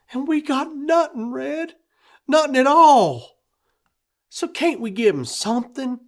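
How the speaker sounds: gentle plea. A narrator's reading. Rose pleads gently.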